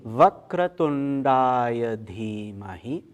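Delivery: slow intoned Sanskrit chant, vowels held long; leading mantra recitation. Shown as a demonstration. Teacher leading the group through the Ganesha Gayatri, slow mantra recitation. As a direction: intone each phrase slowly, leaving room to join in. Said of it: "let's try to do that together".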